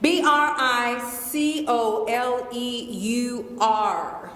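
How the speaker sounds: each letter slow and separate; spelled out slowly. Shown as a demonstration. For the audience member, slow letter-by-letter spelling. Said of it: "could you say it a little slower".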